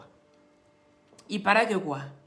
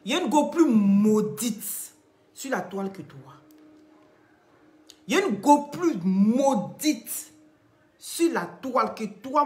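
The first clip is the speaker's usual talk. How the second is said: mocking taunt; 4 semitones higher, 6 dB louder than usual. Real, not acted. Mocking rival on live.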